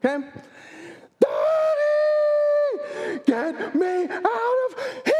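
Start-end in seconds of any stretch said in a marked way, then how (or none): 1.2-5.1 put-on panicked child. Daughter begging dad to rescue her, panicked high child voice. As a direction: plead like a terrified five-year-old.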